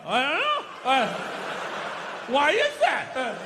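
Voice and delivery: faltering, hesitant mock bafflement; feigned clueless stammer; mock dumbfounded. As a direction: play a sports host pretending not to know. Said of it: "sit there, like, dumbfounded".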